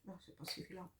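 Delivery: muttered under the breath, almost inaudible; quiet self-correction. Real, not acted. Crafter catching her own mistake, muttering quietly. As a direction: mumble the correction to yourself. Correcting herself while working.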